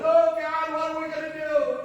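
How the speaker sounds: high, loud, hammed-up crying; mock crying wail. Father re-enacting crying at the car, loud mock wail. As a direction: wail it out in exaggerated despair. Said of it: "and I'm crying".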